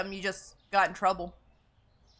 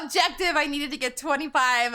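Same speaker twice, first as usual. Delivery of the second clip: ordinary talk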